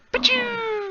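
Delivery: squeaky high pitched pew; high magic zap sound. Acted. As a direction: zap it like a tiny spell. Spell effect on new colors.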